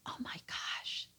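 breathy hushed whisper, slow; a slow, awed inner whisper; whispered thought. Retold or imitated. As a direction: whisper it to yourself in awe.

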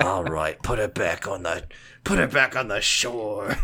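put-on low Australian accent, laughing. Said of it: "You did an outstanding Australian accent".

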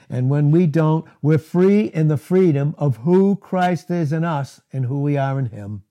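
ordinary talk

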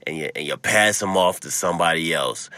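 ordinary delivery

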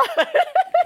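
high shrieking laugh; hysterical laugh. Real, not acted. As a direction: let the hysterics take over. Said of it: "I'm hysterical about this".

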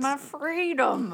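mocking voice. Mocking anti-maskers.

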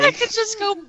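giddy high voice; excited. Real, not acted. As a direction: squeal with glee. Excited gleeful idea.

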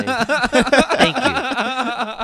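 high laughing thanks. Real, not acted. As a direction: thank him through laughter.

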